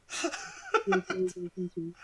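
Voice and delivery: long giggle; laughing hard at the quote; high laugh. Real, not acted.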